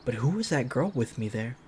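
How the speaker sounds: usual speaking voice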